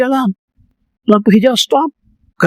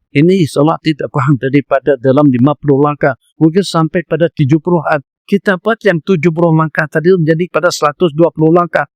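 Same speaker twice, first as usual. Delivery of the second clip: ordinary talk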